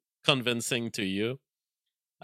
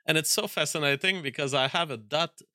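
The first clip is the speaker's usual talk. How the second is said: ordinary talk, nothing marked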